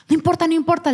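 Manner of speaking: put-on brushing off the child's objection, high and pushy; insistent mother. Mother insisting daughter go ask Raquel, high insistent voice. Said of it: "le dice".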